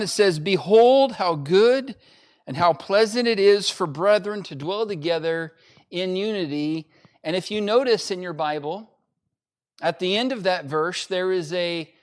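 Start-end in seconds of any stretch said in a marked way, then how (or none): 0.4-6.9 booming scripture proclamation; emphatic exclamation. Shown as a demonstration. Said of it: "decided that this was such an emphatic".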